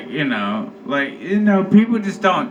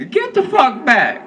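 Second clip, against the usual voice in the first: much higher, louder yell to back off; shouted warning; 12 semitones higher, 4 dB louder than usual. Retold or imitated. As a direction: shoo them away in panic. Fending off giant mosquitoes.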